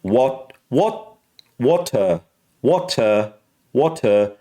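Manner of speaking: British "water" with crisp T, no R; British accent. Shown as a demonstration. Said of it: "So it's water".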